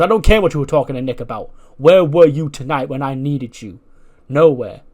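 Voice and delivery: loud, indignant demand; angry retort. Acted. Wrestler demanding where his ally was tonight, angry. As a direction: cut in hard on the excuse. Acted quarrel, cutting off excuse.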